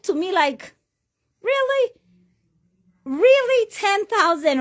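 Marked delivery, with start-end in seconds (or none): 1.4-1.9 very high, rising mock disbelief; incredulous really. Mocking the victims' gullibility. 3.0-4.6 very high and louder, falling at the end; exasperated really. Acted. Mocking the victims' gullibility.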